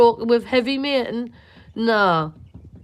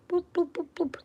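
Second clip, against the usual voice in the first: breathy whispered little boop boop; soft whispered coaxing noises; 8 semitones higher, 7 dB quieter than usual. Retold or imitated. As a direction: coax the chick softly with whispered boops. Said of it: "And I went".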